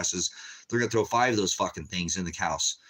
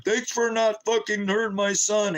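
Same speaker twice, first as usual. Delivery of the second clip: higher, falsely grateful voice; playing dumb; 11 semitones higher than usual. Father faking gratitude to the officers who shot his son, mock-grateful. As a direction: fake thanks to the police, voice lifted. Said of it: "So I'm playing with him".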